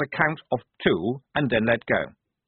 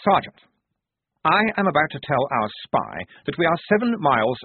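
stern lecture; 7 semitones higher, 4 dB louder than usual. Acted. Officer lecturing insubordinate sergeant.

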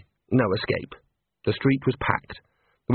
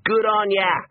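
high-pitched cheer of approval; high encouraging call; crowd cry; 9 semitones higher, slower than usual. A narrator's reading. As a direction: cry it out like an Australian onlooker. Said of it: "plenty of cries of".

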